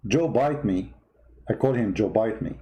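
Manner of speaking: ordinary talk, nothing marked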